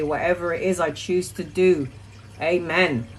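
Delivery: quiet, hushed invocation; murmured morning prayer to guardian angels; soft prayer. Shown as a demonstration. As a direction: pray it softly to the angels. Prays to angels while making bed.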